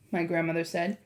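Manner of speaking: plain reading voice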